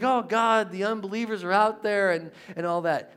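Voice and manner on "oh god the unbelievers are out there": high, louder cry; alarmed David. Retold or imitated. Preacher acting David crying about unbelievers, loud and high. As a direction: call out to God in alarm. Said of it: "He's like".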